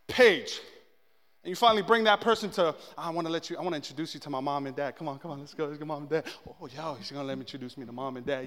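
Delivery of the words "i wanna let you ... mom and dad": soft, fast, eager whisper; hushed excited whisper. Acted. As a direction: whisper it like a giddy secret. Whispered excitement about meeting parents.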